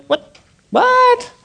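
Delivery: high-pitched, baffled double "what"; startled disbelief. Retold or imitated. Driver reacting to being waved down by police, startled. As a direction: blurt it out in mock bewilderment.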